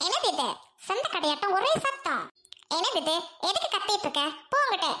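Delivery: haughty sister scolding at her gate; snobbish rich sister. Acted. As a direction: talk down to the noisy crowd at the gate.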